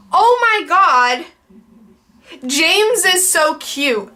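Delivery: mock-adoring, slowly savored praise; gushing grandmother. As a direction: imitate a doting grandmother's comment, stretched out. Voicing grandmother's gushing comment.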